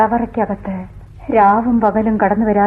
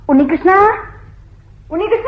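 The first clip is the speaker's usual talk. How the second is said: calling out; 9 semitones higher than usual. Acted. Calling out for Unnikrishnan.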